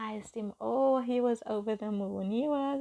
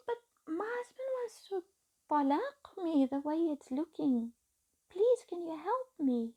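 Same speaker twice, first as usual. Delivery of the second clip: higher, desperate plea; begging; 8 semitones higher than usual. Retold or imitated. Wife begging her teacher for help, desperate. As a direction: plead for help as if everything is at stake.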